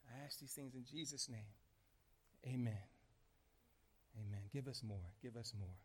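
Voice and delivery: very low, hushed amen; murmured plea, barely voiced; closing prayer. Real, not acted. Pastor closing his prayer in Jesus' name, praying very softly.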